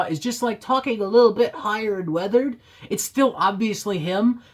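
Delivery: ordinary delivery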